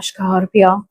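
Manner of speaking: Scorpio said SH-kar-pio, Spanish style; Castilian Spanish. Shown as a demonstration. Reader naming the sign in her Castilian Spanish way. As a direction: give Scorpio a Castilian sh at the start. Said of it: "I like to say it like, um, you know, uh, Castilian".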